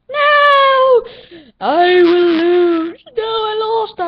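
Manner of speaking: very high, loud drawn-out wail; wailing in defeat. Real, not acted. Gamer losing the round, loud anguished wail. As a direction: yell it out in despair at the loss.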